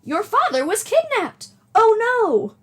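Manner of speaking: high, loud granny cry of alarm; a startled grandmother exclaims; alarmed old lady. Acted. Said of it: "do an old lady voice".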